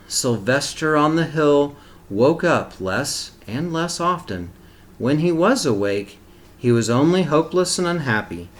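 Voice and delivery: put-on reading a picture book aloud to children; steady, expressive storybook reading; story narration. A narrator's reading.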